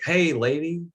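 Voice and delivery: put-on high, bold call of a naive young man; brash boy greeting. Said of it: "and he's like".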